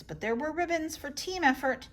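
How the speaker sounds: ordinary delivery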